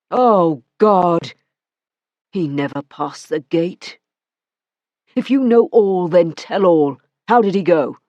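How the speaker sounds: man outraged by her news; Matthew, incredulous. Acted. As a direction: throw her words back at her. Matthew, chilled laughter.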